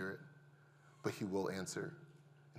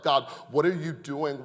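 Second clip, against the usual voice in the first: yelling at God; 4 semitones higher, 7 dB louder than usual. Retold or imitated. Grieving father yelling at God in his backyard, anguished shout. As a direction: cry out to God, louder and higher. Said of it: "I am yelling at God in my backyard".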